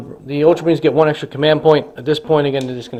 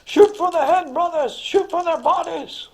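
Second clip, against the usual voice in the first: much higher, slow commander voice; Jonathan's order; slower than usual. Acted. Jonathan ordering his brothers to fire, high command voice. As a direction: bark it like a sergeant to his squad. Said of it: "It's like".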